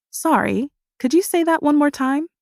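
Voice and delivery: high, sugary, teasing question; sweet mock innocence. A narrator's reading. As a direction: ask it too sweetly, hand at ear. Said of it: "I ask sweetly with a hand cupped around my ear".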